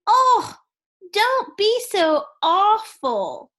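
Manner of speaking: high, loud cry of complaint; high-pitched cry of dismay. Acted. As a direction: wail it like a splashed little girl. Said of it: "Annie Apple cries out".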